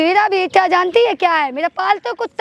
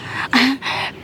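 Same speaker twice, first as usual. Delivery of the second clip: short breathy laugh of contempt; scornful laugh; 5 semitones lower, 3 dB quieter than usual. Acted. Daughter-in-law mocking her mother-in-law's honour, scornful laugh. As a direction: laugh off the old woman's talk of honour.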